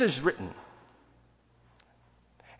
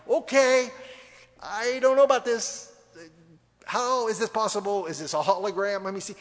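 high, hammed-up startled doubt; mock alarmed disbelief; 5 semitones higher, 3 dB louder than usual. Preacher voicing disciples who see a spirit, mock alarm. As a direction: act rattled and unsure, pitched up.

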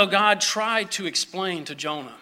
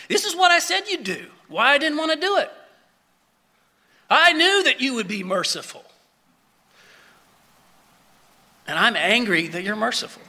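angry Jonah; 4 semitones higher, faster than usual. Retold or imitated. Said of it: "He was angry at God".

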